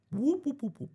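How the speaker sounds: high whoop whoop call; whooping fish call. Shown as a demonstration. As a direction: hoot it high and bright. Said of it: "I'm gonna do it".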